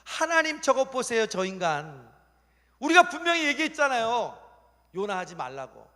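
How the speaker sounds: high, loud I-told-you-so complaint; hammed-up exasperated tattling; protesting angels. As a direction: shout up to the boss in indignant protest. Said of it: "그때 천사들이 뭐라고 그랬을까?".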